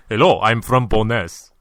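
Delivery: a hammed-up Russian accent as Gaffer; Russian-accented Gaffer, played for laughs; mock Russian Gaffer. Mocking the manager Gaffer on a podcast, hammed-up Russian accent. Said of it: "that was a Russian Gaffer".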